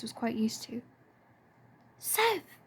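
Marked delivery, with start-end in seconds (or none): 2.0-2.6 a high, delighted cry of his name; Lily calls out thrilled, pitched way up; excited greeting. A narrator's reading. Lily greets her friend.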